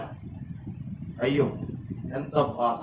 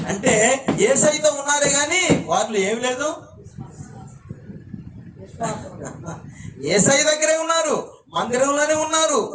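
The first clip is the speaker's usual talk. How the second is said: fiery preaching; 7 semitones higher than usual. Acted. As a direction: preach it up high at the crowd. Preaching at full force.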